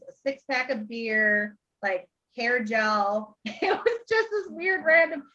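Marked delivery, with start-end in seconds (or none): 3.5-5.2 laughing. Real, not acted. Laughing at odd items.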